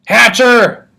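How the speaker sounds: a guest bellowing at his host; booming. A narrator's reading. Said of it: "Mister Yarby boomed".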